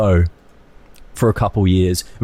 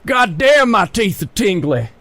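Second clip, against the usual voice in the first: Michael Rooker attempt; 6 semitones higher, slower than usual. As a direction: bark the curse, ease into the quote. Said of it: "It's hard".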